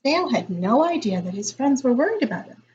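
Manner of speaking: ordinary delivery, nothing marked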